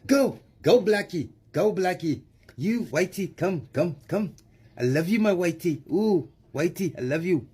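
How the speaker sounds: high-pitched mock South African accent; short high calls in a put-on South African accent; mocking South African, shooing then cooing. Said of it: "I'm doing this with the South African accent".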